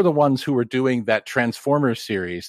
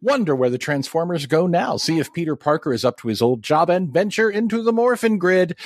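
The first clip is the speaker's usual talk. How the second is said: high, energetic intro read; show intro announcer; 7 semitones higher than usual. Acted. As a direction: hype up the episode like a promo.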